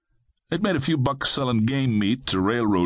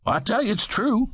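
high, excited voice for Fred; Fred exclaiming; 13 semitones higher than usual. A narrator's reading. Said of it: "Fred exclaimed".

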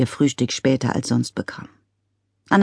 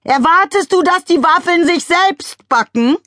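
nagging shout; 5 dB louder than usual. A narrator's reading. Wife nagging husband about waffles, shouting. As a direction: yell the question from the window. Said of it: "kam die Frage vom Fenster her".

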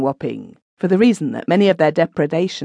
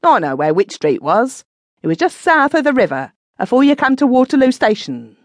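rough man, rising higher as he goes; Ben's gruff insistence; 7 semitones higher than usual. A narrator's reading. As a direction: Ben putting Mrs Dawes right. Ben insists on the street.

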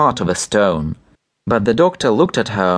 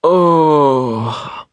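long, higher-pitched growling groan; growled; 5 semitones higher, 3 dB louder than usual. A narrator's reading. Woken doctor answering the pleading wife, growling. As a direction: growl it out wearily. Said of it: "growled the doctor".

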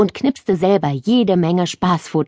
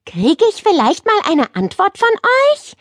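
put-on high, pushy girl's question; a girl fed up with waiting; impatient girl; 13 semitones higher than usual. A narrator's reading. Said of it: "fragte Nele ungeduldig".

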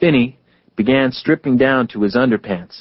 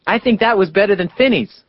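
put-on higher boyish voice of Elwyn; 8 semitones higher than usual. A narrator's reading. Elwyn congratulates Gene after his jump, a higher boyish voice. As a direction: praise the jump brightly.

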